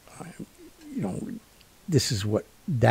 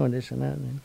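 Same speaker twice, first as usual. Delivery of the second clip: normal talking voice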